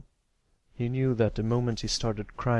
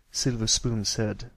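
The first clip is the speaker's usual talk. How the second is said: usual speaking voice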